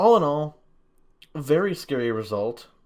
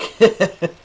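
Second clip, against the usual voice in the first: loud high laugh; sudden cackle of laughter; burst of laughter; 11 semitones higher, 11 dB louder than usual. Real, not acted. Laughs at enemy tank.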